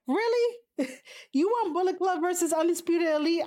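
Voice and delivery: a sharp high really?, can't believe it; incredulous. Real, not acted. Reacting to a viewer's main event pick, incredulous and high. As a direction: pitch jumps way up in disbelief. Disbelief at chat's match prediction.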